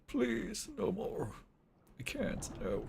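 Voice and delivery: soft, weak, high plea; mock pleading. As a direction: beg quietly, as if it hurts. Mock pleading at hated accent.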